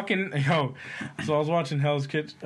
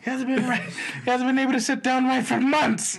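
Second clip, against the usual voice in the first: mock whiny son; 11 semitones higher, 3 dB louder than usual. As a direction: ham up the son ranting to ma.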